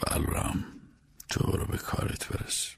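very soft, voiceless husk; choked-up, husky. Acted. Grieving master dismissing his driver, voice choked up. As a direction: send him away with barely any voice left. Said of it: "با صدای گرفته بهم گفت".

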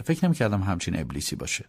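ordinary delivery

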